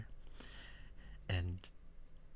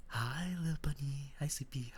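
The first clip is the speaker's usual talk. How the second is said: cutesy greeting; 6 semitones higher than usual. Real, not acted.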